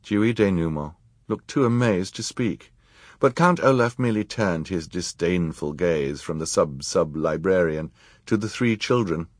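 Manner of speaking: ordinary delivery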